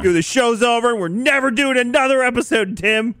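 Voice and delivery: loud, high mock outburst; mock angry. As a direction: fake fury at your co-host.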